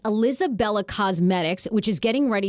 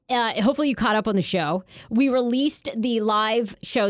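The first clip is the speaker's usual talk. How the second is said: usual speaking voice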